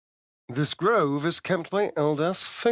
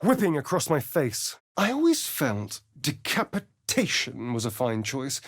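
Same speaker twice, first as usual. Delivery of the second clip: usual speaking voice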